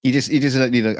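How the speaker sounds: usual speaking voice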